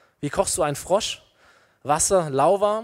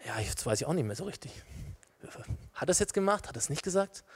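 unsure; 11 dB quieter, faster than usual. Acted. Believer doubting what God said, softly unsure. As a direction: think aloud in quiet confusion.

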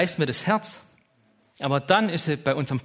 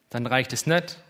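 normal talking voice

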